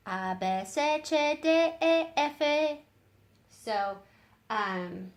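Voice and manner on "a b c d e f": letters said the Spanish way; A, B, C, D, E, F in Spanish letter names; Spanish alphabet. Shown as a demonstration. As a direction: alfabeto en español. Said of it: "and then in Spanish it's".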